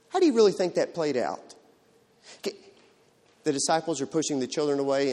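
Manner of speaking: normal talking voice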